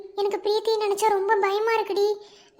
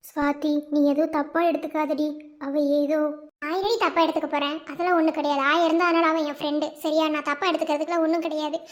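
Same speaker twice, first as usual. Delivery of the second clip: ordinary talk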